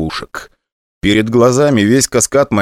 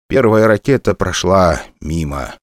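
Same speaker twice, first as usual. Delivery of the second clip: ordinary delivery, nothing marked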